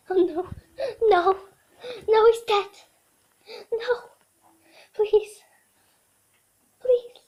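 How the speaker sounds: high, loud, crying begging; hammed-up sobbing dog pleas; desperate pleading. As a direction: wail and beg for the toy as if it were life or death. Dog begs desperately for toy.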